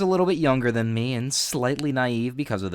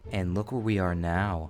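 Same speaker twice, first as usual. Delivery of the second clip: low, soft sultry teacher voice; creepy teacher voice; 7 semitones lower, 5 dB quieter than usual. Acted. Vlogger reads teacher's creepy message aloud, low and soft.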